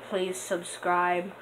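slow, stretched please subscribe; begging slowly for subscribers; pleading long and slow; drawn-out plea. Real, not acted. Asking viewers to subscribe.

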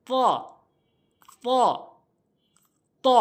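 "ত" as heavy Arabic emphatic t; full, separate "ত", tongue tip at front-tooth roots; ত demonstration. Shown as a demonstration. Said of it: "এভাবে". ("ত", জিহ্বার আগা দাঁতের গোড়ায়)

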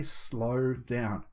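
very slow, drawn-out words; deliberately lengthened hypnotic phrasing. Shown as a demonstration. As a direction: stretch out each word to model slowing down. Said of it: "intention of slowing things down".

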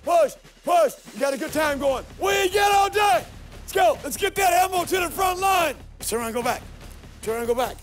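barked drill commands, high pitched. Real, not acted. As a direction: drive recruits like a drill instructor. Sergeant yells through obstacle course.

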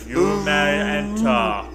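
slow, loud, booming welcome; grand gatekeeper voice. Pretend. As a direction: announce it like a huge creature granting entry. Voicing the giant fish's invitation.

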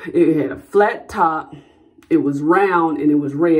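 normal talking voice

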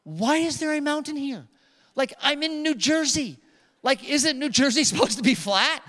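high, incredulous complaint; exasperated inner thought. Retold or imitated. Pastor voicing his own thought on the hill, high and incredulous. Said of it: "my first thought is".

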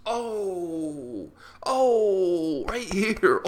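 overcome. Real, not acted. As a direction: it still gets to him, let it burst out.

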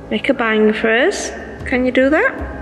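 ordinary talk, nothing marked

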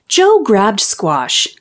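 usual speaking voice

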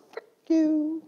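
high, mock snappy brush-off; playful mock defiance; defiant retort. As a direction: toss it off like a comic rebel. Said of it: "I become a".

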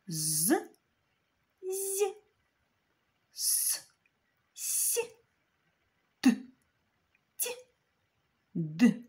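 each consonant twice, hard then soft; З, з, с, с, т, т, д, д, л, л as hard-soft pairs. Shown as a demonstration. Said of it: "Тверді і м'які".

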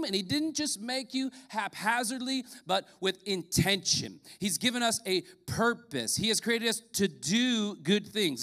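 ordinary talk, nothing marked

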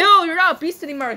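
fast, high, loud rejection; rapid, hammed-up disgust; pretend disgust. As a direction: blurt it out in mock revulsion. Pretend disgust at changed beast.

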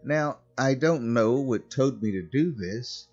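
plain reading voice

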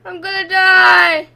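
loud wail swelling on the last word; loud, stretched panicked cry; terrified wail. Real, not acted. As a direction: cry it out loudly in dread. Terrified in horror game.

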